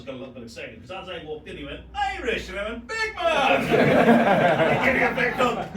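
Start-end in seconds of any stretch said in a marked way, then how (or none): 1.9-2.5 high, loud friendly call of a name. Retold or imitated. Prince calling out to his friend as he walks in. Royal calls out to him. 2.9-3.6 high, loud cheerful shout back; calling out. Retold or imitated. Greeting the prince loudly as he walks in. 3.6-5.8 slow, loud, laughing through the words; giggling as he gets it out. Real, not acted. Retelling the royal hug to the host, laughing.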